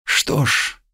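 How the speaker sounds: hushed, breathless whisper; barely audible whisper. Acted. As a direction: let it slip out as a whisper. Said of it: "едва слышный шёпот". (едва слышным шёпотом)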